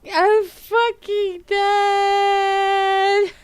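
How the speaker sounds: very high, long-drawn loud wail; hysterical amusement. Real, not acted. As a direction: scream it out slowly, helpless with amusement. Screaming with amusement at comment.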